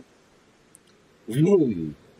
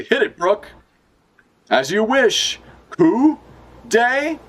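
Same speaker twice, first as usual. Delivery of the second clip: excited launch call; 13 semitones higher, slower than usual. Acted. Reading launch dialogue.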